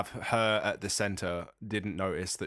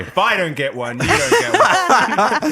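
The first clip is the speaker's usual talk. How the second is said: laughing; 11 semitones higher, 11 dB louder, slower than usual. Real, not acted. Laughing mock threat.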